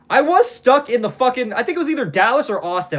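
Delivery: high, worked-up exasperation; animated, exasperated griping. Real, not acted. Recounting a long airport ordeal.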